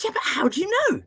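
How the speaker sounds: very high, pressing question; insistent retold question. Therapist pressing the boy, high and insistent. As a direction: push back, pitched right up.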